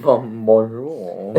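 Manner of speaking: slow drawn-out fake French greeting; mock French accent. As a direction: stretch it out like a cartoon Frenchman. Mocking French after riz talk.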